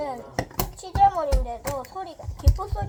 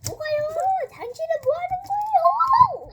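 squeaky mock voice; 14 semitones higher, 7 dB louder than usual.

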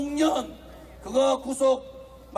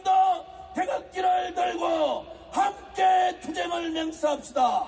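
fiery rally speech; 5 semitones higher than usual. Acted.

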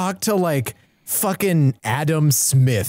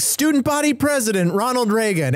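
raised pitch, mock recital; mock history lesson; 7 semitones higher than usual. Host mocking history books that stop at college, mock recital. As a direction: recite it like trivia, lightly put on.

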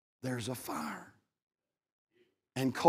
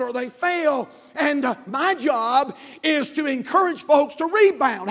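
high, loud preacher shout; preaching at full force; 11 semitones higher, 7 dB louder than usual. Acted. Pastor urging failed folks to rebound, preaching at full force. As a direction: preach it out to the congregation.

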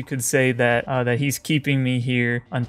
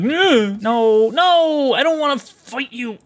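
mock guard; 9 semitones higher, slower than usual. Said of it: "he's like".

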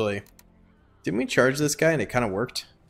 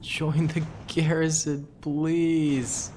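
higher, slow begging; pleading; 6 semitones higher, much slower than usual. Pretend. Gamer begging his troops to join the garrison, high and slow. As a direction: whine it like a plea.

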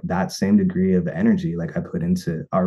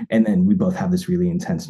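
ordinary delivery

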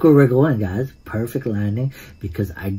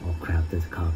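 hushed alarm; 6 semitones lower, 12 dB quieter than usual. Real, not acted. Spots cop while sneaking.

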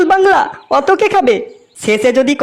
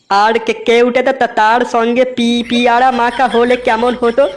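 stammers on first syllables; stuttering; slower than usual. Acted. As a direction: trip over each word's start. Stuttering friend Todla speaks. (তোতলানো কথা)

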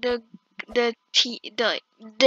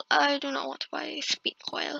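normal talking voice

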